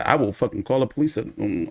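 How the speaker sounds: ordinary talk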